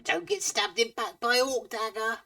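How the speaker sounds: high, squeaky ratfolk whisper-talk; squeaky rat man. Acted. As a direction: play a twitchy little rat man, very high. Rat man warns them.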